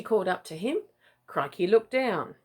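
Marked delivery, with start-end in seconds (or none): none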